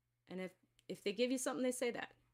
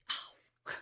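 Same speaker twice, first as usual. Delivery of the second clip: ow. Real, not acted. Hits her knee.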